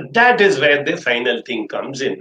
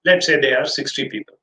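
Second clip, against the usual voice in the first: normal talking voice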